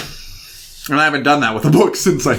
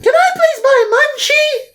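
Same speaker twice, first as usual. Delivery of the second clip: put-on much higher, pleading child begging Mom; whiny kid; slower than usual. Co-host as a child begging his mother, shrill plea. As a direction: squeal it like a kid begging for a toy. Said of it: "You're going".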